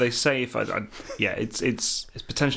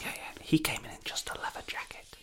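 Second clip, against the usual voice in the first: hushed whisper; 5 semitones higher, 8 dB quieter than usual. Acted. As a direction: whisper it behind his back. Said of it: "why are you whispering so much?".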